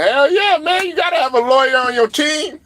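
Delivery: high-pitched, hyped outburst; excited exclamation. Real, not acted. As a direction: cry it out high, thrilled at the idea. Hyped reaction to having a lawyer.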